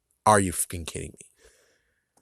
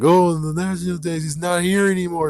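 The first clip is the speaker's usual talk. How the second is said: higher mock-sad complaint; mocking whiny fans; 7 semitones higher than usual. Mocking fans upset about the missing National Dex, higher mock whine. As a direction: lightly whine like a disappointed fan. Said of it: "and then you guys are like".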